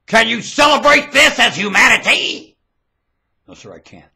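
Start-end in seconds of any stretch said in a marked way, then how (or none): 0.1-2.6 loud, high demand; high-pitched preaching yell; shouted challenge. Real, not acted. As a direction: challenge the crowd at full voice.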